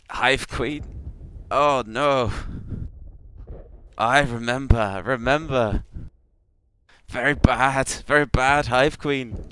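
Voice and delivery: high, loud, agitated creature voice; fretful insect-creature, halting broken speech; Clicknid creature. Acted. Creature recalling the bad Hive Queen, high alarmed creature voice. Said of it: "And he goes".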